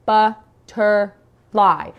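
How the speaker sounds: "butterfly" said very slowly, jaw dropping each syllable; over-articulate "butterfly" slowly; syllable demo. Shown as a demonstration. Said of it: "using the hand under the jaw like this".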